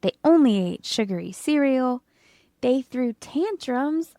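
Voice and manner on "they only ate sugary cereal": usual speaking voice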